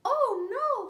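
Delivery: mock fright. Baby bird frightened by the snort, high mock-scared squeal. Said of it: "said the baby bird".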